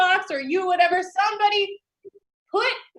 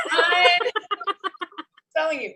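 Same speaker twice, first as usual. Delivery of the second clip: ordinary delivery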